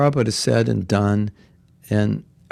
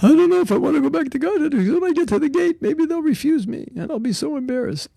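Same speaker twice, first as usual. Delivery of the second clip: mock fearful devotee; faster than usual. Mocking devotee afraid of rejection.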